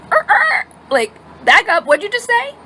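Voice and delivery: high, sputtering disbelief; stammer, then shoot up in shock; mock outraged mom, high.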